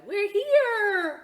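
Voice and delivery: put-on very high, loud, squealing child; excited little boy. Little boy thrilled to reach the cafe, squealing. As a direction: squeal it with delight.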